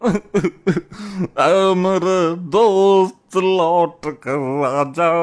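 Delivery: long drawn-out mock lament; hammed-up plea to a lost friend; theatrical wailing. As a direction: wail it very slowly, stretching every word.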